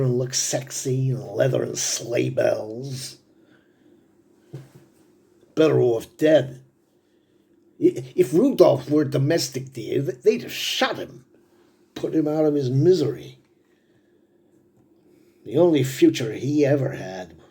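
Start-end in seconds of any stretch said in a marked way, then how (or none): 5.5-17.4 high, loud and worked up; agitated outburst. Acted. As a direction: blurt it out, stumbling with agitation. Father agitated over son's fate.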